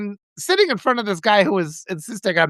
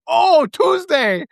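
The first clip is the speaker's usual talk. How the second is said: high, loudish, drawn-out oh of discovery; mock realization; 10 semitones higher, 4 dB louder, much slower than usual. Confused guy finally working out the day, mock realization. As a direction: imitate someone slowly catching on.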